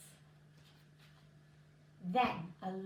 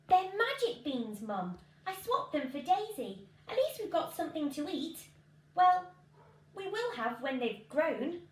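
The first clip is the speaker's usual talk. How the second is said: high, louder eager pleading; eager explaining; 7 semitones higher, 6 dB louder than usual. Acted. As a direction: talk fast and keen to win mum over. Jack defending swap to mum.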